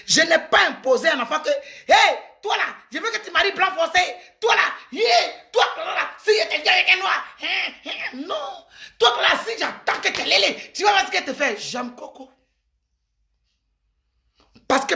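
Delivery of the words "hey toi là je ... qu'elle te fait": raised, higher scolding voice; a parent laying down the law; mock scolding parent. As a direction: bark at the child like an angry parent. Parent scolding child, acted.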